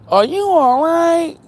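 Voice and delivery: high, loud, slow sugary "are you all right"; fake-nice question at the stranded woman; mock sweet concern. Asking the gossiping lady, mock sweet high concern.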